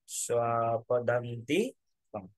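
slow, stretched vowels in স্বপদান্তিকম; স্বপদান্তিকম drawn out. Shown as a demonstration. Teacher showing the dash rule, স্বপদান্তিকম pulled long. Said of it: "একটু করে টান দিয়ে".

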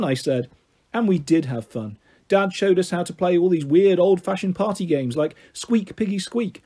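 usual speaking voice

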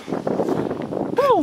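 a very high, airy woo of amazement; breathless whoop. Real, not acted. Vlogger cheering a baby snapping turtle, airy and thrilled.

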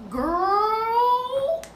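put-on drawn-out rising "Girl,"; excited call. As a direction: call your girlfriend in, dying to spill. Calling a friend.

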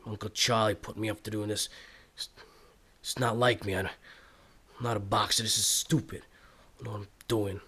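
low, halting self-talk; brooding to himself. Acted. Boxer alone, doubting the fight his uncle arranged, brooding. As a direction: think it out under your breath, unsure.

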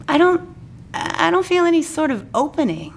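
high, worried doll voice; Barbie voice. Acted. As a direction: squeak out the worry.

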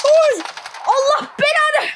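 high, loud, slow curse; angry curse. Real, not acted. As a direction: spit out the curse in fury. Cursing after dying. (yüksek sesle öfkeli beddua)

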